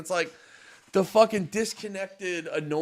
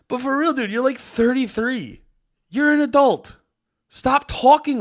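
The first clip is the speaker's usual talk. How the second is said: higher and louder, telling him to grow up; real exasperated call-out; exasperated scolding; 10 semitones higher, 5 dB louder than usual. Real, not acted. Telling off the streamer.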